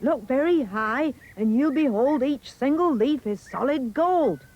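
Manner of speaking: put-on high, loud, swooping boy; excited cry. A narrator's reading. Jack pointing Mum to the gold leaves, excited cry. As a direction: cry it out as a marvel. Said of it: "cried Jack".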